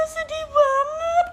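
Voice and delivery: high, slow, tearful wail imitating a kid; mock sobbing sister; imitating a crying little sister. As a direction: whimper it out like a weepy child. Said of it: "langsung nangis".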